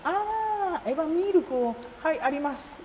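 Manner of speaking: high, bright mock clerk "Ah, Eva miruku"; Japanese shop clerk. Clerk finally recognising the milk he wants, mock high voice. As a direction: imitate the clerk suddenly understanding, pitched high.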